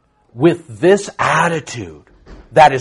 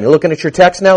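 ordinary talk

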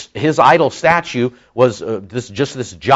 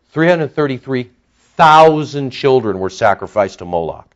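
ordinary talk, nothing marked

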